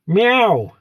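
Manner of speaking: high mock cat meow for a viewer; playful meow. Viewer named Catwoman greeted.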